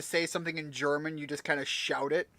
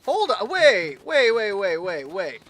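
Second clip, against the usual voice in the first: alarmed; 4 semitones higher, 6 dB louder, slower than usual. Real, not acted. Pikmin wandering off, panicking.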